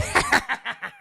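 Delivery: loud, rapid evil laugh; gloating villain cackle; goblin cackle. Acted. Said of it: "the Goblin's like".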